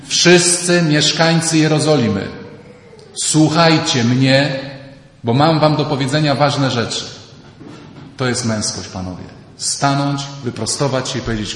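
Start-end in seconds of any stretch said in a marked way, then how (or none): 0.1-7.0 loud, slow commanding call to the crowd; commanding public address. Acted. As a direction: Peter addressing all Jerusalem boldly. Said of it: "przemówił donośnym głosem".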